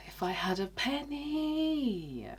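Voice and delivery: breathy high rueful whisper, slow; wry whisper. Real, not acted. Ruefully admitting past mistakes, slow high whisper. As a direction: sigh it out ruefully.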